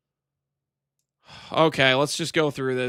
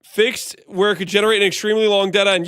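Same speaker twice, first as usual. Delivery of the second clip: ordinary talk, nothing marked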